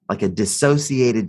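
ordinary talk

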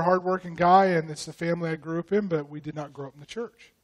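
normal talking voice

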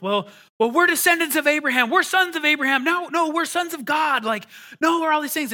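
high, loud indignant protest; protesting Pharisees. Retold or imitated. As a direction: push back like offended religious leaders. Pharisees pushing back on Jesus.

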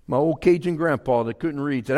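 ordinary talk

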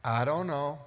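a lightly voiced Cain, shrugging it off; preacher voicing Cain with a light touch; Cain's evasive answer. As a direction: play Cain dodging the question, only a hint of character. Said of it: "Now watch the response".

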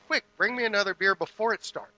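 loud, high, impatient demand for beer; mock bossy husband yelling for beer. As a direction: bark it out like a pushy husband. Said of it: "he said".

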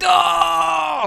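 very high, drawn-out "oh"; retold cry of dismay. Retelling the crew losing the pike, high dismayed cry. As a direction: imitate a whole boat's letdown cry. Retold group groan at lost fish.

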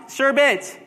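"sherbet" said as "SHER-bit"; sherbet without extra r. Shown as a demonstration. Pastor polling the other camp, no-r sherbet. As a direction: end "sherbet" on a short bit. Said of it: "there's no R after the E".

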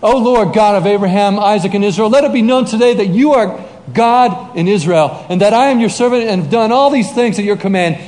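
fervent plea, easing down at the close; prayer cried out. Retold or imitated. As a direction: imitate a prophet appealing to God. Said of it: "Elijah cries out".

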